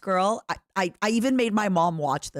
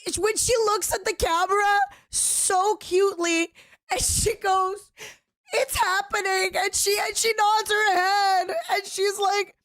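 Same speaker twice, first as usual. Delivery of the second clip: teary; 10 semitones higher than usual. Real, not acted. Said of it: "I'm, like, laugh crying".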